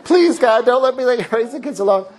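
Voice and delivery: high, frantic plea of a worried wife; hammed-up wife praying in a panic; mock panicked wife. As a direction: play the wife begging God. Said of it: "Rachel's probably freaking out, like".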